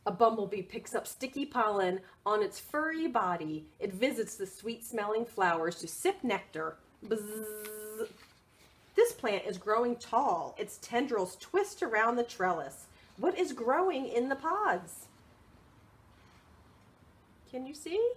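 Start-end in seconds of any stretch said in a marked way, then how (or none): 0.0-6.8 lively picture-book read; reading to children. Acted. 7.0-8.1 soft bee buzz; quiet playful buzz. Pretend. Bumblebee buzzing in story. 8.9-15.1 lively picture-book read; reading to children. Acted.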